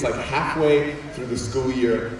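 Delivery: ordinary talk, nothing marked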